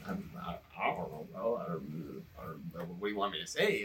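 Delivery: low, very soft, hesitant mumble; meek stammering. Retold or imitated. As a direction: falter helplessly under your breath, acting it out. Retold meek reply to angry friend.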